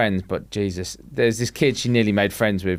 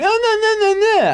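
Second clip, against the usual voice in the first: high-pitched, sneering imitation; louder, exaggerated mockery; mocking; 4 dB louder than usual. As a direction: mimic someone in a squeaky taunting singsong. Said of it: "she was mocking her by going".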